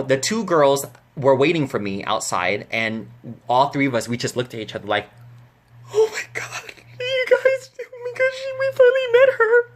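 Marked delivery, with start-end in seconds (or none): 5.9-9.7 very high, squeaky near-sob, imitated; acted tearful fangirl squeak; overwhelmed fan squeal. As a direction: squeal it as if crying with joy. Acted fans squealing after meeting idol.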